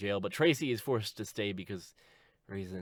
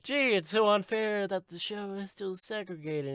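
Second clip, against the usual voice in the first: high-voiced mock innocence; gee-whiz naive complaint; Tracy; 8 semitones higher than usual. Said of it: "Tracy's like".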